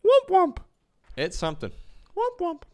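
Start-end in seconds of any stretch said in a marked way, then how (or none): 0.1-0.6 very high, squeaky falsetto; cute VTuber noise. Retold or imitated. Streamer practicing a VTuber catchphrase, cute falsetto. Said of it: "some cute noise that she does". 2.1-2.6 very high falsetto squeak; cute VTuber noise. Retold or imitated. Streamer mimicking a VTuber's noise, cute falsetto. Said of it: "some cute noise that she does".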